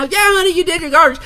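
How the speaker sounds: very high-pitched put-on mother voice; exaggerated high mom impression; mock mom. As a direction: play a mom answering her kid in falsetto. Acted mom answering the kid.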